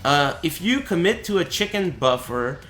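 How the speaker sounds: ordinary talk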